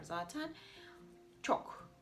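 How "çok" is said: ordinary talk, nothing marked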